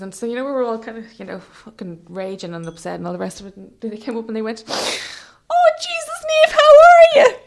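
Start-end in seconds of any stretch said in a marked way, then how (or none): 4.7-7.4 very high, loud squeal; hammed-up shrieky older-woman greeting; excited fan greeting. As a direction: squeal it, building louder to the end. Imitating older women greeting her excitedly.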